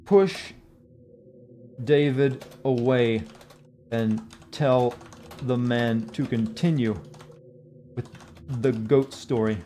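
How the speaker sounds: slow, with long gaps between phrases; halting, word-by-word dictation; dictating while typing. Real, not acted. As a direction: speak each bit as you type it.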